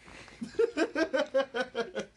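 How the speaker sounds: squealing laugh; high shrieking laugh. Real, not acted.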